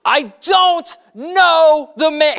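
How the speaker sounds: high, slow, desperate oath; sworn denial. Acted. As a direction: swear it out, drawn and pleading. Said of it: "He goes farther".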